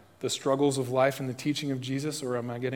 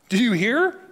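the question 'Do you hear?' much higher and louder, the word 'hear' rising; loud rising challenge; 9 semitones higher, 8 dB louder than usual. Real, not acted. Preacher challenging his congregation, calling out 'Do you hear?' high and loud.

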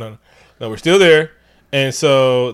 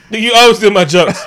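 mock indignant; 8 semitones higher, 8 dB louder than usual. Real, not acted. Mock complaint to cohost.

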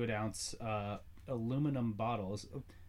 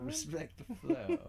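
mocking echo; 13 semitones higher than usual. Mocking partner's line.

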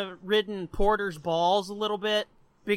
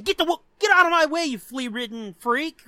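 fast, loud, higher mock tirade; Daphne snapping; 4 semitones higher, 5 dB louder than usual. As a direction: act out shooing someone away angrily. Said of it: "Then she goes in the back".

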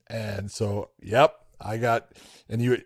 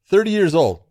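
THIRTY punched louder and higher; host marveling at early retirement; emphatic disbelief; 7 semitones higher, 6 dB louder than usual. Real, not acted. Stressing Jim Brown's age.

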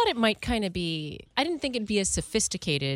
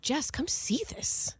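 calling her sister; 9 semitones higher, 5 dB quieter, slower than usual. Retold or imitated. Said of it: "I'm like".

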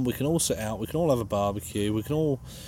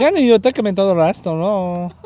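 high, loud hammed-up Mexican accent; mock Mexican accent; 8 semitones higher, 7 dB louder than usual. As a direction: ham up a bad Mexican accent. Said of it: "Your accents are worse".

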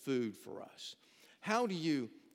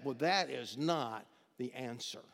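ordinary talk

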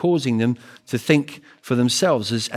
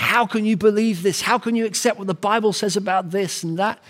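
mock incredulous Piers Morgan, higher pitched; challenging interviewer; 8 semitones higher than usual. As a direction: press him hard with disbelief. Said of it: "And he was saying".

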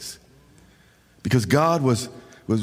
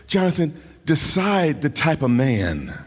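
usual speaking voice

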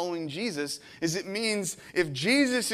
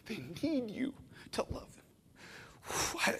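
breathy hush, slow, voice nearly gone; emotional whisper; 5 semitones higher, 8 dB quieter, much slower than usual. Real, not acted. Pastor whispering a plea to the congregation, emotional. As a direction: hold back tears and just whisper it. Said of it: "you get so darn emotional up here".

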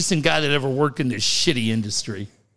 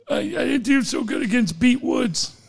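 normal talking voice

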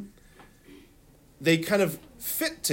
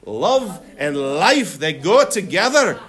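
a slow, louder try at a Scottish accent; 6 dB louder, slower than usual. Shown as a demonstration. Teacher tries a Scottish accent for the class. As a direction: have a go at a Scottish lilt. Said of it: "With a Scottish accent".

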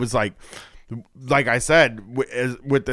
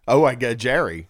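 usual speaking voice

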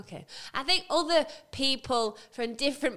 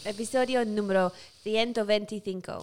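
lower, measured Spanish pronunciation; Spanish accent; 4 semitones lower than usual. Shown as a demonstration. Co-host repeating it for her sister, careful Spanish accent. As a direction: say it carefully in Castilian Spanish for copying. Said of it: "say it again".

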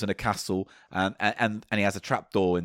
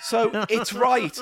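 high, laughing exasperated rant; shaking with anger; 11 semitones higher than usual. Real, not acted. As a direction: rant at him, half laughing. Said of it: "I am shaking with anger right now".

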